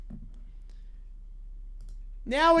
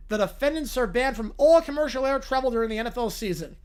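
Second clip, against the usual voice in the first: usual speaking voice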